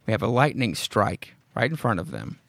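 ordinary delivery

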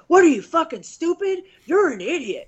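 high, loud mock yell at a fighter; imitated yelling coach. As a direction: bark the insult like a cornerman. Husband's coach yelling at him.

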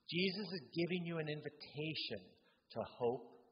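usual speaking voice

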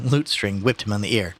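usual speaking voice

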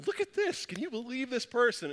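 high-pitched, incredulous retold complaint; mock indignation. Preacher replays showing his wife a text, incredulous. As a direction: act out scandalized disbelief, pitched well up.

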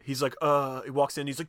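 ordinary talk, nothing marked

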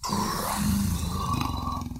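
low, drawn-out grumble; rumbling tummy noise. A narrator's reading. Narrator imitates the mysterious rumbling, low drawn-out growl. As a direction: stretch the word into a rumble.

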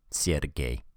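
usual speaking voice

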